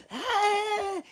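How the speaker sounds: high mock shriek of the upset mom. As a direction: squeal like the outraged mother. Mom gets upset, voiced.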